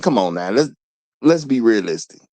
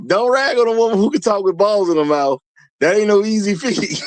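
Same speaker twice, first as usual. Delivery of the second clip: higher pitched, words bent by laughter; laughing; 7 semitones higher than usual. Real, not acted. Host rereading a viewer's joke, laughing through it. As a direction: read it again as you crack up.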